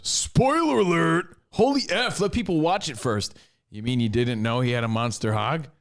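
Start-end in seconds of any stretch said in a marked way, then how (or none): 0.0-3.3 slow, high mock outrage; hammed-up irritated jerk; mock annoyed jerk. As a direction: drag out the complaint like a grumpy fan. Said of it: "Jeff the Jerk Man goes".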